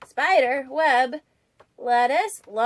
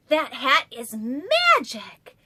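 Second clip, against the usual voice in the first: awed; slower than usual. A narrator's reading. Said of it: "gasped Mole".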